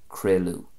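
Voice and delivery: "crealú" as KRAY-loo; Ulster pronunciation. Shown as a demonstration. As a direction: say "crealú" ending on a U sound. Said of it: "a U sound in Ulster Irish".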